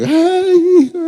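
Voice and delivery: high crying wail of a father at the airport; retold crying. Father crying as his son leaves at the airport, high tearful wail. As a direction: wail it through tears, reliving the goodbye. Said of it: "yo estaba llorando ahí".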